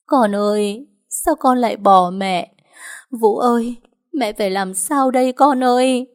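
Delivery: a mother keening over her son, voice climbing; mother crying out for her dead son, sobbing. Acted. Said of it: "khóc nấc lên".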